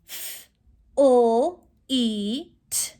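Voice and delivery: "F, L, E, T" as isolated sounds; sounded out. Shown as a demonstration. Teacher counts sounds in fleet, segmented. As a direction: break "fleet" into four separate sounds. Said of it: "How many sounds are in fleet".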